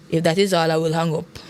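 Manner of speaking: toneless, unmoved dismissal; flat voice. A narrator's reading. Husband answering his pleading wife, flat voice. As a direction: shut the plea down without feeling. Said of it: "replied in a flat voice".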